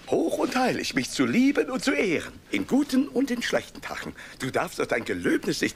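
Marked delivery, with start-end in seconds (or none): 0.1-4.1 raised pleading. Acted. Husband reminding his wife of her vows, high and urgent. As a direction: throw her vows back at her, rising.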